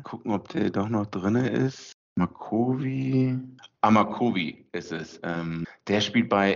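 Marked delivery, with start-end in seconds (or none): none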